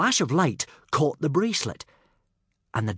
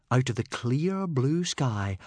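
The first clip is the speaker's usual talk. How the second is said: ordinary delivery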